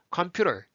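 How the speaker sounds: 컴퓨터 with a quick R-like tap for the T; American-style tapped 컴퓨터; flapped T. Shown as a demonstration. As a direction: loosen the T of 컴퓨터 into a flap. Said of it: "그럼 말이 바뀌죠".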